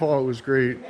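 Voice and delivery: usual speaking voice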